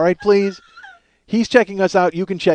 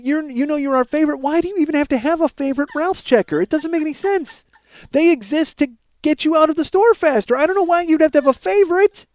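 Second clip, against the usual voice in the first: fed-up raised rant ending in a laugh; high exasperated rant; 11 semitones higher than usual. Real, not acted. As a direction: pile on the disbelief. Ranting at wife's lie.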